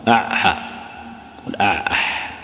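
"أع" from the throat, then "حاء" breathed; ain and ha sounded out. Shown as a demonstration. Teacher to students, sounding out the throat letters "أع" and "حاء" slowly. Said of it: "العين ثم الحاء".

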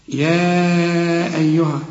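slow chanted يا أيها; Quran recitation. Shown as a demonstration. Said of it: "خدناه".

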